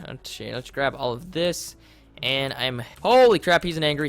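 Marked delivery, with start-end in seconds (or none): 3.0-3.6 much louder, higher yelp; startled yelp. Real, not acted. Player startled by an angry wisp, loud yelp. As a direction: jump at the sight.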